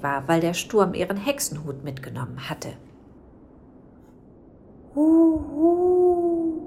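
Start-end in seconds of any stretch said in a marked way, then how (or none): 4.9-6.6 high, loud ghost hoot; ghostly hoot. Acted. Little ghost calling to the sad witch with a high hoot. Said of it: "schuhute die kleine nebelige Gestalt".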